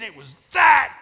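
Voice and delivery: big, high shout on "that big"; hammed-up boastful shout; shouted punchline. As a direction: bellow it slowly, word by word. Punchline of uncle's fish tale.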